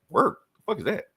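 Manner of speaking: high, incredulous squeak; baffled disbelief. Retold or imitated. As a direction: sound utterly baffled by the idea. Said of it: "He's like".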